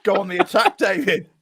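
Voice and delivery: high-pitched mock telling-off easing down; playful exasperated manager-bossing; acted phone instruction. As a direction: order him about as if down the phone. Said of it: "I phoned him up. I said".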